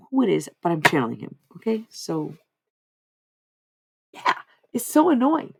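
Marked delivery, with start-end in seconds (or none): none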